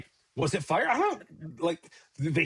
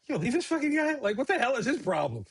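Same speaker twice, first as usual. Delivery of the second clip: much higher, incredulous venting; exasperated aside; 9 semitones higher than usual. Retold or imitated. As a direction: squeal it out in disbelief. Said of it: "Jesus being like".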